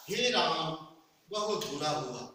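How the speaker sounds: sorrowful "हे Ram"; grieving exclamation. Shown as a demonstration. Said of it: "जब मैं इस तरह कहता हूँ".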